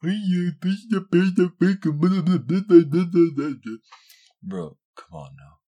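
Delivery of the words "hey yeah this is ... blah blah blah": much higher, louder sneering voice; hammed-up mock poser voice; mocking fake woke person. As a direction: parody them with a shrill gush. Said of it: "fake woke motherfuckers be like".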